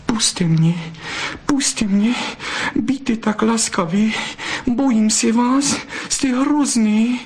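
very high, panicky begging; frightened pleading. Acted. Flustered vixen to the fox who holds her, frightened pleading. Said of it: "zaprosila bystrouška".

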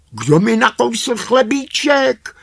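very high, loud dwarf question; high-pitched, loud little-dwarf voice; indignant third dwarf. A narrator's reading. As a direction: squeal the complaint as a little dwarf. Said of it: "ptal se třetí".

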